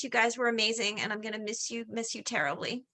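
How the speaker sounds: ordinary talk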